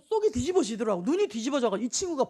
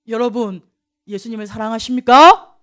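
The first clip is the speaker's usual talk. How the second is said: loud, rising question to the crowd; calling out to the congregation; 4 semitones lower, 11 dB louder than usual. Real, not acted. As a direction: ask the whole room, full voice. Preacher calls question to congregation.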